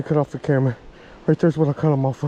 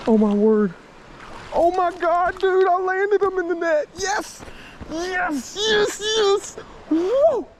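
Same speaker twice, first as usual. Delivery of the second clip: high, breathless joy after landing the fish; real elation; 13 semitones higher, slower than usual. Real, not acted. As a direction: celebrate the catch, pitch way up.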